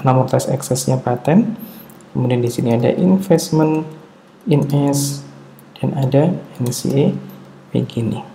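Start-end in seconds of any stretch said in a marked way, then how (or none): none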